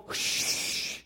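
long unvoiced "shh"; hushing. Onlooker telling the griever to be silent, long shush. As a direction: shush someone with a drawn-out hiss. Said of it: "and not be told".

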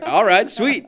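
high, bright-eyed "all right, sweet" with a laugh in it; excited reply. Retold or imitated. Answering the casting guy, excited and high.

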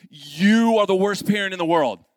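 loud, outraged accusation; loud exaggerated parental panic; not calm. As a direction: blow up at your partner in a retold outburst. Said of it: "I am not a calm person".

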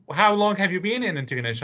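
chatty Maritime grandmother asking kindly; elderly woman. Retold or imitated. Old woman asking newcomer.

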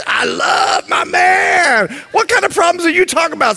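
hammed-up lovesick squeal; infatuated bride. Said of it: "I just love you".

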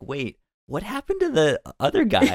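high, laughing bewildered question; amused disbelief, voice jumping up; mock bewildered. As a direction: play up the confusion with a laugh. Quoting own confusion, laughing.

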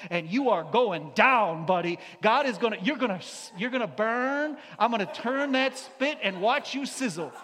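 loud, mocking fire-and-brimstone rant, rising to a shout; mock hellfire preacher. Mocking internet preachers who condemn sinners, loud rant. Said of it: "See, it didn't say here".

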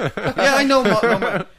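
high, loud mimicry through laughter; mock the eager heckler while cracking up; laughing imitation. Repeating the heckler's reply, laughing.

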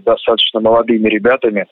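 ordinary talk

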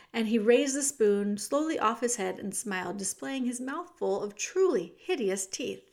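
usual speaking voice

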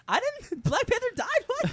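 shocked disbelief. Retold or imitated. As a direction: squeak out the alarm, half laughing. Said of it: "I was like".

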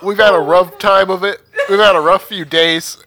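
nervous pleading. Acted. Pleading with the dragon.

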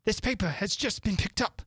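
high, eager official voice. A narrator's reading. Rabbit presents the paper.

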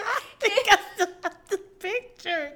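high squeal through real laughter; helpless laughter. Real, not acted. Coworker confessing to keeping embarrassing photo, helpless laughter. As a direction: barely get the words out laughing.

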